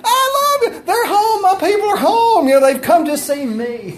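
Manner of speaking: high, giddy voice of an overjoyed dog; excited dog. Acted. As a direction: squeal it like a pet thrilled to see its people. Voicing his happy dog greeting owners.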